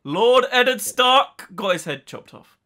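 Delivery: loud, slow, grand declaration; Jon Snow. Retold or imitated. Answering who his father was, grand loud proclamation. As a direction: proclaim it high and drawn out. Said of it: "and he was like".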